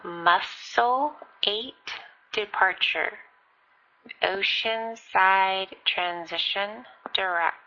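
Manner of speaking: slow, spaced out words; drawn out for a pilot who missed it; slow and deliberate. Real, not acted. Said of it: "repeating it one more time".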